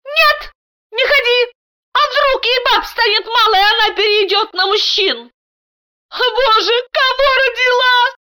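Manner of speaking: very high panicky wife; squealing. A narrator's reading. Wife begs husband not to go in, squealing. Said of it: "Даша взвизгнула".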